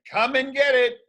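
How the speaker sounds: high, teasing taunt; taunting villain. Acted. Petey taunting Dogman toward the bath. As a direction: lure him in with a sneer.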